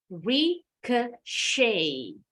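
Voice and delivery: Ricochet drawn out very slowly as RIK-o-shay, no T; slow model of Ricochet with the T silent. Shown as a demonstration. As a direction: stretch Ricochet out, ending on a long SHAY. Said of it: "Huruf T-nya bunyinya jadi E".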